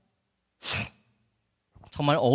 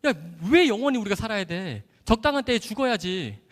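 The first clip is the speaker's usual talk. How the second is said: louder, higher incredulous retort; retold disbelief; 5 semitones higher, 5 dB louder than usual. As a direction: protest as if it's absurd, drawing out the question. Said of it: "제가 너무 황당했어요".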